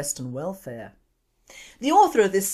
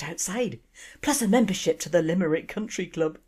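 Irish daughter. Acted. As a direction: list the gifts with mock innocence in an Irish lilt. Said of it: "excuse the accent".

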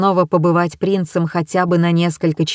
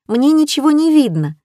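put-on excited young girl; 8 semitones higher, 3 dB louder than usual. A narrator's reading. Fawn jumping in crowd.

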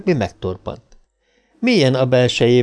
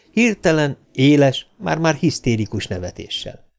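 usual speaking voice

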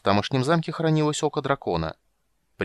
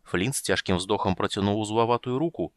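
plain reading voice

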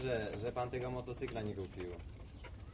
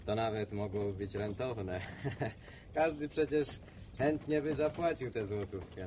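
ordinary delivery

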